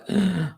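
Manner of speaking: sharp, pretend intake of breath; mock shocked gasp. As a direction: gasp as if balking at the price. Imagined reaction to the price.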